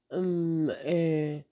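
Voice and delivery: halting, unsure ums; hesitant mumbling. A narrator's reading. Nagare stammering as he tries to recall the girl's arrival. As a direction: fumble for words, trying to remember. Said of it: "منمن کنان گفت".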